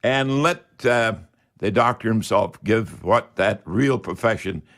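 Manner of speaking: ordinary talk, nothing marked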